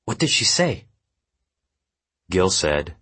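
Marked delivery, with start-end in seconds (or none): 0.1-0.9 high-pitched pressing question; tense, raised-pitch demand; urgent questioning. A narrator's reading. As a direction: press anxiously for an answer. Jundo questions Gil urgently.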